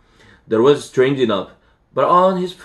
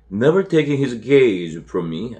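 ordinary delivery

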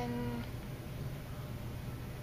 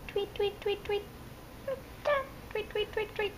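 put-on high chirpy tweet tweet for a toddler; bird tweets; 7 semitones higher, 6 dB quieter than usual. Shown as a demonstration. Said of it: "what's a bird say?".

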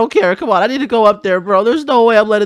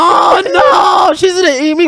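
panicked wail; 7 semitones higher than usual. Acted. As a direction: wail like you're doomed. Learns mom ate the dad.

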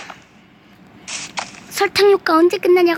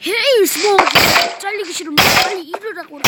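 loud, high shout at a worker; boss yelling; 4 semitones higher, 3 dB louder than usual. Pretend. Boss yells at SpongeBob to work, loud high shout. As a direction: bark like an angry boss.

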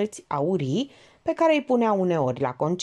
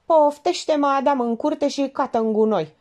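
raised, high indignant retort; huffy, nettled; 7 semitones higher, 4 dB louder than usual. A narrator's reading. Georgeta bristles at being called a dreamer, nettled. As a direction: bristle and snap back. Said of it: "făcu Georgeta oțărâtă".

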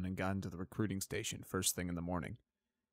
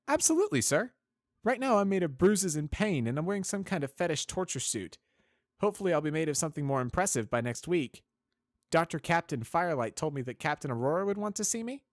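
much higher, loud wry cadet; a much higher, sardonic young cadet; 10 semitones higher, 8 dB louder than usual. Acted. Spitfire voiced.

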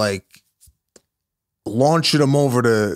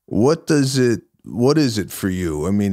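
ordinary delivery

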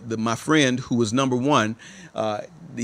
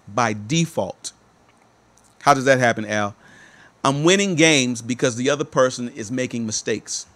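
ordinary delivery

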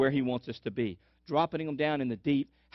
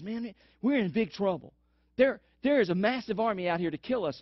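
panicked servant; 7 semitones higher than usual. Acted. Servant warning Elisha of the army, panicked. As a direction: sound the alarm like a frightened servant. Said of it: "And the servant says".

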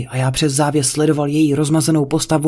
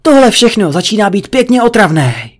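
loud, higher-pitched exasperation; angry snap; 5 semitones higher, 5 dB louder than usual. A narrator's reading. Husband fed up with wife's prank, angry snap. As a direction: let the irritation burst out, raised voice. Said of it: "Vyjel jsem na ni".

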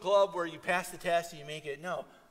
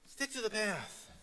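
soft repeat; 6 semitones higher, 6 dB quieter than usual. Acted. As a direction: stick to the path said much softer, pulled back. Soft repeat after loud call.

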